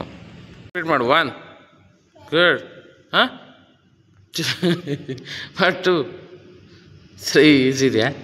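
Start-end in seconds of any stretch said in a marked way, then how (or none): 4.3-5.6 real chuckle trailing downward; amused laugh. Real, not acted. Coach amused by a tearful pupil, chuckling.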